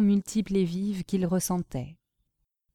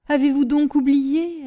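higher, louder plea, a touch of tears; emotional raised-voice reproach; tearful, acted; 5 semitones higher, 5 dB louder than usual. A narrator's reading. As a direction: plead as if close to tears. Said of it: "d'une voix pleine de larmes".

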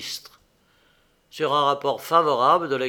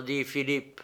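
plain reading voice

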